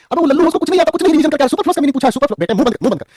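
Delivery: mock scolding. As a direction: tell off the student in jest. Teacher mock-scolds a student. (छात्र को मज़ाक में डांटते हुए)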